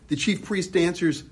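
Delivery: ordinary delivery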